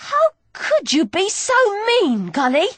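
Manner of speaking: high pained protest; wounded and pleading; hurt cry. Acted.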